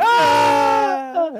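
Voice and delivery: high mock scream; cartoon falling scream. Homeless shelter guest plunging into a volcano, cartoon falling scream. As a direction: scream like a cartoon character dropping away.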